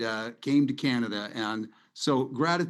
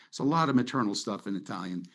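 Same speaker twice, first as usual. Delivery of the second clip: usual speaking voice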